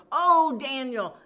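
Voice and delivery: loud, slow acted wail; lamenting call. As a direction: wail the name out slowly in mock woe. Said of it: "a lamentable voice".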